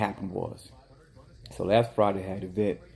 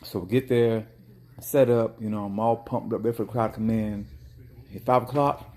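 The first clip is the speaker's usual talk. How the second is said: ordinary talk, nothing marked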